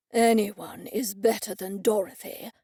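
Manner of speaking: dismissive huff; grumbling old man. A narrator's reading. Grumpy old man replies.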